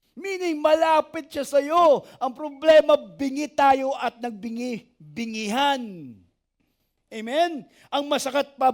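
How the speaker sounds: ordinary talk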